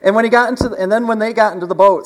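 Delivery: loud, high preacher drive; full-force preaching. Acted. Pastor resuming the story, loud and high. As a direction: push the point home loudly.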